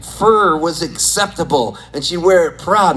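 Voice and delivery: ordinary delivery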